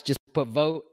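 ordinary talk

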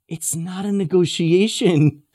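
loud, slow, emphatic statement; emphatic correction. Retold or imitated. Teacher answering Pharaoh's haggling, emphatic. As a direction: spell it out loudly and slowly.

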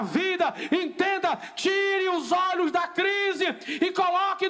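pulpit crescendo, shouting the refrain; full-force preaching. Acted.

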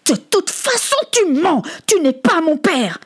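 high-pitched furious denial; angry accusation. Acted. Son glaring at Butler denies he is his father, angry. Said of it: "les poings serrés".

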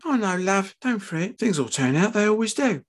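high-pitched, soothing wife; consoling wife. A narrator's reading. Wife comforting her upset husband, consoling.

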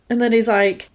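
ordinary delivery

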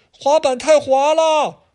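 put-on very high, drawn-out child's whine; whiny kid.

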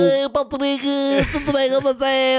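high cartoon sponge voice; squeaky cheerful cartoon greeting; SpongeBob voice. Imitating Bob Esponja voice.

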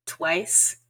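ordinary talk, nothing marked

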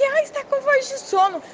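high, loud sing-song mockery of a sleepy voice; mocking teasing quote. Ridiculing the people who tease her sleepy morning voice, mocking sing-song. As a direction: mimic the teasing message in a high, loud sneer. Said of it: "ouvindo gracinha quando me mandam mensagem".

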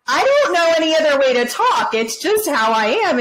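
high-pitched, helpless self-defence; mock protest. Acts out own helpless retort.

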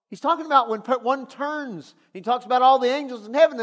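ordinary delivery

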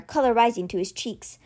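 usual speaking voice

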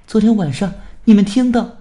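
loud, high-pitched yell to someone at a distance; calling out. A narrator's reading. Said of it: "她们冲着司马子健喊道". (高声喊话)

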